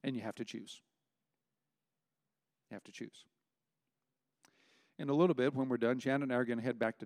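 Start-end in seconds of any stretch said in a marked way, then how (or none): 0.0-0.8 softer plea. Real, not acted. Preacher's closing plea, dropping quieter. 2.7-3.3 "You have to choose" almost murmured, much softer than before it; hushed plea. Real, not acted. Preacher pressing the congregation, hushed and earnest.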